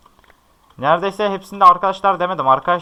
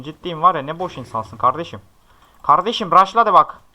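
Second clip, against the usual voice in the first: ordinary delivery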